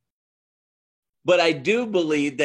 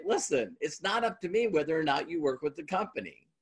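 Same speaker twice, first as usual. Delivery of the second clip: the line dropped much softer; softer repeat; 8 dB quieter than usual. Shown as a demonstration. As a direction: hush it, let it sink in. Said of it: "And I would say".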